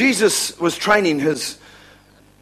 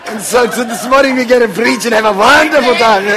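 loud, higher put-on Indian accent; 4 semitones higher, 5 dB louder than usual. Preacher demonstrating a morning sermon, mock Indian accent. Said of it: "preach with the Indian accent".

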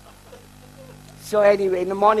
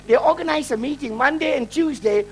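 ordinary delivery

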